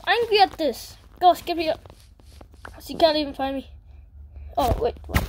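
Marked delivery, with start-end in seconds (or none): none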